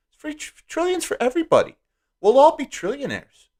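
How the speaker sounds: high, mock-excited squeal; squeaky sarcastic cheer; sarcastic hype. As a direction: gush over free money in a put-on high voice. Sarcastic hype about free trillions.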